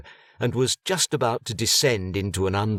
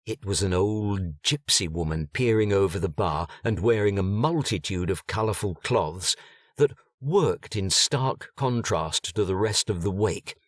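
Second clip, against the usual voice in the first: plain reading voice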